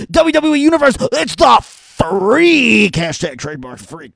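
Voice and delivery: hammed-up marketing hype; mock corporate announcer. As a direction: sell it like a cringe TV plug. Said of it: "So when he's like".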